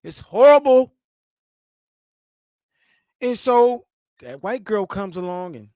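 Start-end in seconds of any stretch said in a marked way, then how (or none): 0.0-3.8 high, loud, hammed-up cry of horror; mock girlfriend gasp of horror; mock outraged. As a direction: squeal it like a shocked girlfriend. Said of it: "it's just horrible".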